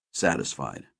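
plain reading voice

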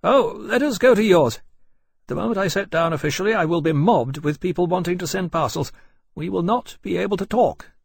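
high, lively, friendlier officer's voice; warmer courier captain. A narrator's reading. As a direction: agree eagerly, pitched high. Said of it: "with a little more warmth".

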